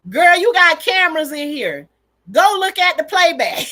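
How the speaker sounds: loud, high-pitched order to herself; urgent inner voice, voiced out loud; sudden realization. Mother telling herself to check the cameras, loud sudden realization. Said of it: "then the thought hit me".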